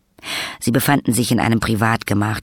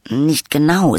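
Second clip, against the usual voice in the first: eager, higher and louder; eifrig; 7 semitones higher, 5 dB louder, slower than usual. A narrator's reading. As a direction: jump in keenly, pitched up. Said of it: "sagte Pyrgus eifrig". (eifrig, höher und lauter)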